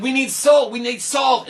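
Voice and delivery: loud high chant for salt; the crowd calling out; loud rising demand; demanding guests. Acted.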